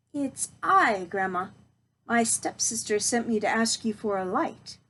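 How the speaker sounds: ordinary delivery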